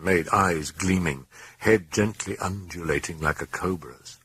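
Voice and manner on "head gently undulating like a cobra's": ordinary delivery, nothing marked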